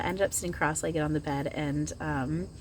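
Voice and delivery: ordinary talk, nothing marked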